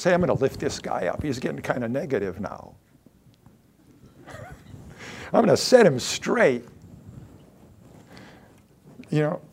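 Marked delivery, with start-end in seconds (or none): 0.0-6.6 smug Peter. Retold or imitated. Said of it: "He probably thought he was gonna encourage Jesus".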